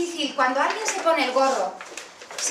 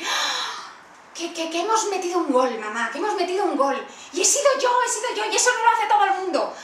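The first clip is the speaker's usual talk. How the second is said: put-on much higher, louder, fast gushing child; thrilled kid; 5 semitones higher, 6 dB louder than usual. Son tells mum he scored a goal, gushing high and loud. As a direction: burst out like a boy who just scored.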